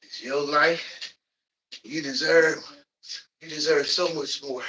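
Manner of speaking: ordinary talk, nothing marked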